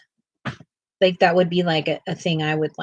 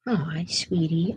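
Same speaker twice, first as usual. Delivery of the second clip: slow breathy whisper to the dog; hushed tender coo; soft coo; 2 semitones lower, 6 dB quieter, slower than usual. Real, not acted. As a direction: whisper tenderly to soothe her. Comforting the pet.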